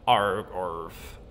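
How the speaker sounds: low barking dinosaur grunts. Pretend. Player voicing a dinosaur reply, low grunts.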